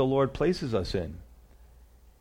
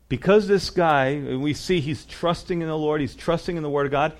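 ordinary delivery

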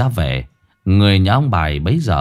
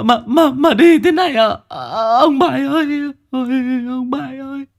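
much higher, keening wail of grief; wailing cry. Acted. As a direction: sob it out over her husband's body. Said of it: "bà Bài rú lên một tiếng".

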